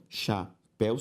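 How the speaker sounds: "chapéu" split as cha, péu; syllable by syllable. Shown as a demonstration. Teacher separating the word for students, syllable by syllable. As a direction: pause inside "chapéu". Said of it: "se eu separo chapéu".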